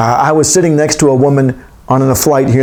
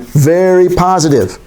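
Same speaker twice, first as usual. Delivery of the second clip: "very positive" drawn out slowly and pitched up; slow, raised emphasis on "very positive"; underlined emphasis; 4 semitones higher, slower than usual. Shown as a demonstration. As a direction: lean hard on "very positive", stretching it. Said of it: "and then they underline it".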